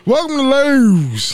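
high, drawn-out Baltimore O in Lowe's; mock a Baltimore greeter, leaning hard on the O; Baltimore accent. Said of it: "he put strong emphasis on the O and the W".

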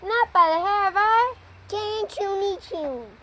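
high squeaky little pigs. A narrator's reading. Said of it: "cried the little pigs".

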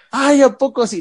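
high, taunting, mocking voice; mocking coworker. The waiter's coworker taunting the upset fan, high and mocking. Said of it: "uno de sus compañeros dice".

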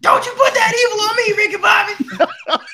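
very high, half-laughing mock cry; hammed-up wail. Co-host quotes a classic line to Ricky Bobby, high mock wail.

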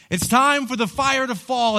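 loud, high cry on "time"; loud preaching call. Acted. Preacher rallying his church for revival, loud. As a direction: burst out with the full force of the call. Shouted repeat after quiet line.

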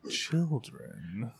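hushed, halting creepy imitation; mock creepy. Teasing co-host's creepy segue back, mock creepy. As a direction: let the last word sink eerily soft.

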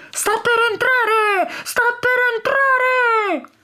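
high, slow, drawn-out cry of alarm; bird shouting. A narrator's reading. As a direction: call the warning out slowly, high up. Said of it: "si mise a volare urlando". (grido d'allarme acuto e lento)

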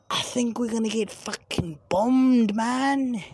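high, strained mock Australian; hammed-up Australian alarm, drawn out in places; Australian accent. Blackout witness fearing terrorists, in a high put-on Australian accent. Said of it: "I'm gonna just keep that Australian accent".

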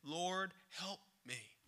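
quiet, humble mother's plea fading out; soft pleading. Acted. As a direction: beg under your breath. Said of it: "So she pleaded".

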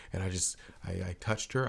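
ordinary talk, nothing marked

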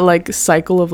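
usual speaking voice

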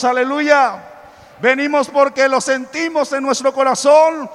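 loud, rising preacher's call; full-force preaching. Acted. Preacher stirring the congregation, loud and rising. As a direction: fire up the congregation with each line.

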